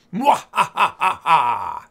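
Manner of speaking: slow, loud evil cackle; mad scientist laugh. Acted. Mad scientist plotting trap.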